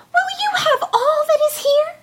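high, long call at full might; crying out loudly. A narrator's reading. Tom Thumb cries out in the parson's room, high and loud. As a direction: call out to wake the house. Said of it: "cried out with all his might".

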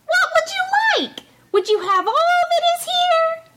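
very high ringing call; crying out again. A narrator's reading. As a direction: shout it out pretending not to hear. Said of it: "cried out again".

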